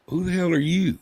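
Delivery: high, indignant retold challenge; cantankerous. Patient confronts an unknown young doctor, cantankerous and high. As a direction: challenge the stranger, pitched well up. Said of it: "I'm an old cantankerous part".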